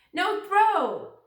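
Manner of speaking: put-on loud, high toddler protest; high loud toddler no throw. A narrator's reading. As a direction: shout it like a stubborn little kid. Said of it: "Fudge said very loud".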